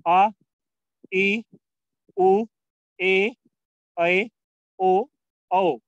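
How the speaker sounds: each long vowel held and sounded clearly; long Hindi vowels drawn out, one by one. Shown as a demonstration. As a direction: stretch every vowel out like a teacher. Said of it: "ये आपके long vowel".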